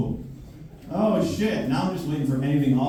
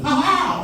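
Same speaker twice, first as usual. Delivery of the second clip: high triumphant "ha ha"; escaping man's high triumphant laugh; gleeful ha ha. Retold or imitated. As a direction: cackle like an escaping hero. Man slipping free.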